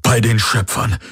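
mild shocked exclamation; blurted oath. A narrator's reading. Hox sees the attack outside, blurted oath. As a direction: let the oath out, aghast but restrained. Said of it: "stieß Hox aus".

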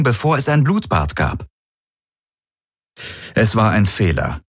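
ordinary delivery

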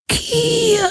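very high, distant call of her name; eerie calling voice. A narrator's reading. Familiar voice calls Kirai out of nowhere, eerie distant call. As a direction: call out like a disembodied voice. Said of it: "eine vertraute Stimme ihren Namen rief".

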